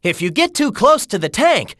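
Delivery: ordinary delivery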